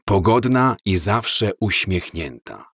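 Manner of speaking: usual speaking voice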